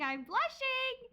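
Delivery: high, slow, coy I'm blushing, hammed up; mock bashful. Shown as a demonstration. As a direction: play it shy and flustered, over the top.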